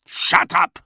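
high, loud, angry snarl. A narrator's reading. Officer cutting off his prisoner, snarling. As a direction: snarl it to silence him. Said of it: "the officer snarled".

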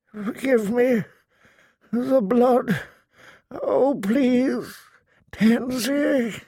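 put-on high, strained plea of a dying old man; thick with pain. A narrator's reading. Delirious father begs Tansy's forgiveness, voice thick with pain. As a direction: beg forgiveness through the pain. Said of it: "in a voice thick with pain".

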